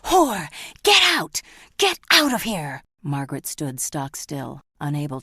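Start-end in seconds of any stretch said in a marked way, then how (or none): 0.0-2.8 full-throated scream, far higher and louder; furious father screaming his daughter out. Acted. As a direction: hurl it at her with all your rage. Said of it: "he screamed".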